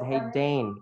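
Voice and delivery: ordinary talk, nothing marked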